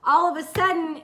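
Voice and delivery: ordinary talk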